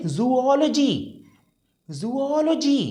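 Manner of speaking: "जूलॉजी" as zoo-OL-uh-jee; correct pronunciation. Shown as a demonstration. Teacher modelling for learners, "जूलॉजी" as zoo-OL-uh-jee. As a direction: separate the two o sounds in "जूलॉजी". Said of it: "इसको हम बोलेंगे".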